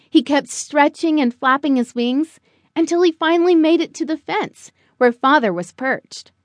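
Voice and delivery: ordinary delivery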